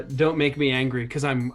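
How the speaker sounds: ordinary talk, nothing marked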